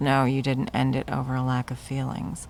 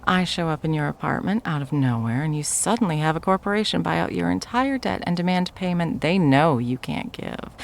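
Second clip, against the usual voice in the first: ordinary talk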